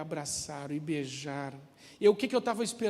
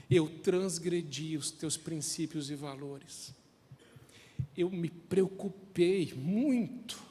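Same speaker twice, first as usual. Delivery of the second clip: prayer of confession. Acted. Leads a slow prayer of confession. (oração lenta e contrita)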